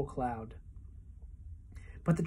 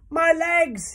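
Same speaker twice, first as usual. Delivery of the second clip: much higher, louder outraged cry; angry cry; 12 semitones higher, 7 dB louder than usual. A narrator's reading. Centipede cries out in anger about his painted legs, high and loud. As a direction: scream it in fury. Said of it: "he cried".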